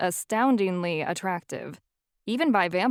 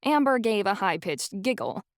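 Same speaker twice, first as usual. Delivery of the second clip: ordinary delivery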